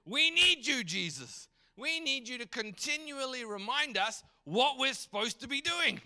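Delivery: high-pitched, urgent protest; worked-up church calling after Jesus; acted alarm. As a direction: plead as if left behind by your leader. Acts out the church missing Jesus.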